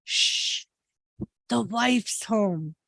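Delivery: soft, slow, secretive shush; hushing. Retold or imitated. Mike warning friends the wife's home, hushed imitation. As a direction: imitate someone hushing the room. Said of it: "He'll go".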